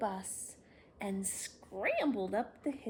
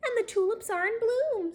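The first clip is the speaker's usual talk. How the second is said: happy; 11 semitones higher, 9 dB louder than usual. Acted. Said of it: "said Marilyn".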